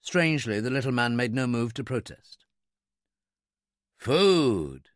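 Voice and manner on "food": awed, drawn-out single word; reverent. A narrator's reading. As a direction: breathe out the word in wonder, stretched long. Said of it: "Miller said reverently".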